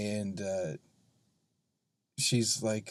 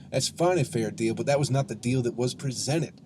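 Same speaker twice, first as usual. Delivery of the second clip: usual speaking voice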